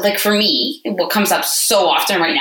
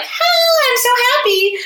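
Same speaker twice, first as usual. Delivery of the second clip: sarcastic sing-song chirp, much higher; mock cheerful; slower than usual. Parent mocking an instant mood flip, high mock cheer. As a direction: overdo a fake switch to happiness. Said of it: "you're like".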